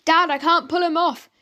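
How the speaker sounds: a frantic cry to Dad; screamed. A narrator's reading. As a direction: yell to Dad while fighting the cat. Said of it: "screamed Frank".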